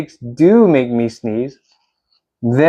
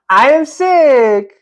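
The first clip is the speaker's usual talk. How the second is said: delighted realisation; 8 semitones higher, slower than usual. Acted.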